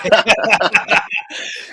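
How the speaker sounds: loud, high real laugh; big laugh. Real, not acted. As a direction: laugh out loud at your own joke. Laughs at his own advice.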